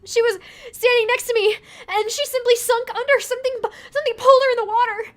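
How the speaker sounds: high, loud, frantic; panicked. Acted. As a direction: blurt it out in panic. Panicked tourist explains.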